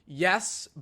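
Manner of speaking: usual speaking voice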